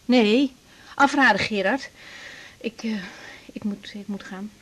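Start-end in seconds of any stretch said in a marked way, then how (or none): 2.6-4.5 zacht, gefluisterd en haperend; zenuwachtig. Acted. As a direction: mompel het gejaagd weg. Zenuwachtig weg naar examen. (co-assistent zenuwachtig fluisterend)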